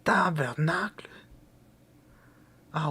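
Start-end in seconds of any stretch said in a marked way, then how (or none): 0.0-1.1 whispered tabernacle with a rising end; Québécois swear. Shown as a demonstration. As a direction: breathe out tabernacle like a Quebec curse. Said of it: "Il part comme ça".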